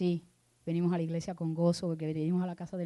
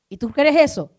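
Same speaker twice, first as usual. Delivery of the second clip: pregunta fuerte y alta, retando al público; lánzale la pregunta a la congregación con fuerza; pregunta desafiante; 9 semitones higher, 15 dB louder than usual. Acted. Pastor challenges the congregation. (pastor reta a los fieles con pregunta fuerte)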